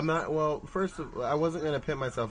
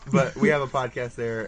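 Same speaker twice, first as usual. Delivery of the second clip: ordinary talk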